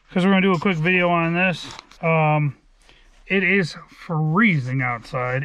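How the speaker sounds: normal talking voice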